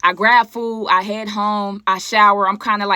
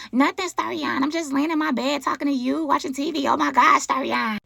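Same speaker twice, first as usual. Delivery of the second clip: higher, girly put-on voice; her forced voice; 5 semitones higher, 3 dB quieter than usual. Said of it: "That's her voice".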